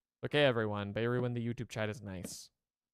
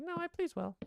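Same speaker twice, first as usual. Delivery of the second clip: high silly dog voice. Pretend. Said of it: "He's like".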